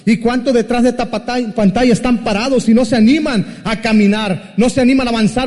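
preaching at full force. Acted. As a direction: preach it out to the screen. Preacher exhorts the online audience.

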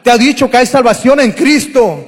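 loud, high altar call hammered home; full-force preaching. Real, not acted. Preacher urging the congregation to believe, shouting high. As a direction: preach it hard, pleading with the congregation.

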